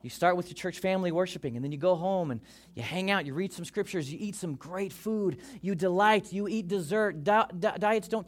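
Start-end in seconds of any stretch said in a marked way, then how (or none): none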